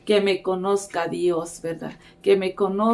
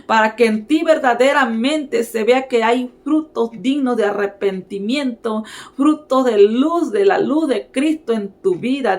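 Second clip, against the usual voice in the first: raised, insistent sermon voice; fervent preaching; 3 semitones higher than usual. Real, not acted. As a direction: preach it with urgency, pitch lifted on each new phrase. Preaches with rising force. (predicación ferviente)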